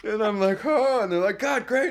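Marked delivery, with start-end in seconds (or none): 0.6-1.0 very high, loud laughing cry; hammed-up startled yelp; stunned oh. Stunned after the take, retold. 1.4-1.9 very high, loud mock approval; hammed-up director's delight; imitated praise. Crew praising the take, retold.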